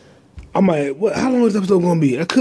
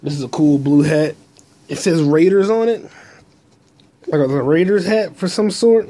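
usual speaking voice

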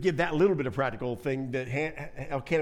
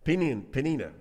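ordinary delivery

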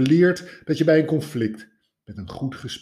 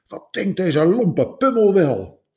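higher and louder indignant outburst; indignant thought, raised; 9 semitones higher, 6 dB louder than usual. A narrator's reading. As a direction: scoff at the lout in a raised voice. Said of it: "hoe hij bozer en bozer werd".

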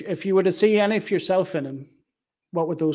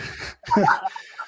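a quick unvoiced laugh at the joke; amused little laugh; short laugh; 5 semitones higher than usual. Real, not acted. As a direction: let a brief airy laugh out. Laughs at wife remark.

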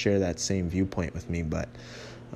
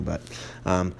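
ordinary delivery

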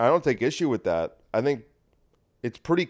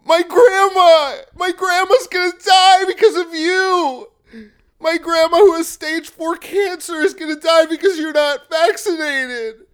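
much higher and louder, slow wailing plea; hammed-up hysterical grandma plea; hysterical woke; 8 dB louder than usual. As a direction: wail it with a fake sob and laugh. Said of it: "the wokes go".

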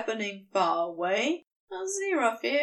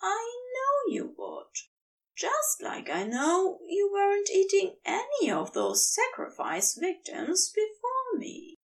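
much higher, fondly knowing tease; higher Aziraphale, knowing and fond; fond teasing Aziraphale; 7 semitones higher than usual. Acted. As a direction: tease him high and affectionate.

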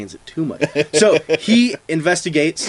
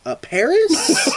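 high, slow, laughing hesitant guess; nervous liar; 11 semitones higher, slower than usual. Acted. German pretending to be from Paris, slow high nervous guess. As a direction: drag it out like a bad lie, pitched high.

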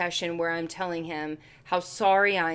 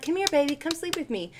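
sweet coaxing to cat; 7 semitones higher, faster than usual. Retold or imitated. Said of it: "And I'm like".

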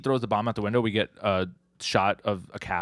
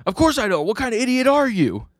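much higher, loud acted indignation; indignant outburst; 7 dB louder than usual. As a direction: snap back, loud and incredulous. Indignant retort to daughter question.

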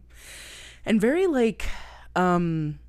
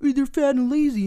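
mocking fat-shamer; 7 semitones higher, 3 dB louder than usual. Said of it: "when people are like".

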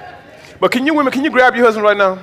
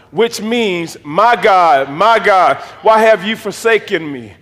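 emphatic, measured Bible reading; scripture read-aloud; much slower than usual. Acted. Preacher reading Jesus's cry to the congregation, emphatic. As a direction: read out the cry with weight on each phrase.

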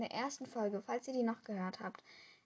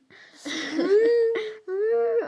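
high, loud fake wail; hammed-up crying; mock crying; 13 semitones higher, 13 dB louder than usual. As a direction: burst into exaggerated pretend sobs. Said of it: "Ich fang ganz gemein".

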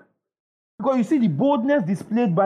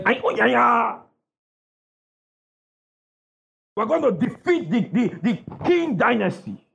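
mock samurai general; 3 semitones higher than usual. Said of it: "And he talks like that".

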